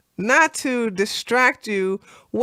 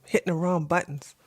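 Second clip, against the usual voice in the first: usual speaking voice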